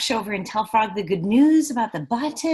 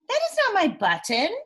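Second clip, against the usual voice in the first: wailing Toad; 8 semitones higher, 3 dB louder than usual. Acted. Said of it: "wailed Toad".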